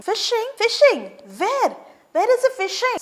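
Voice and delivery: high eager Fishing? Where?; excited question. Retold or imitated. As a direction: grab at the news of fishing. Retold father excited.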